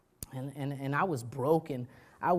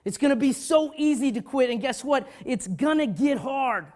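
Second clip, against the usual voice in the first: preaching at full force; 8 semitones higher, 8 dB louder than usual. Acted. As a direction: hammer it home.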